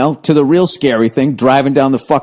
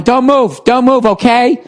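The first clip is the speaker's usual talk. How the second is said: fussing lady; 8 semitones higher than usual. Retold or imitated. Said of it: "she just kept going".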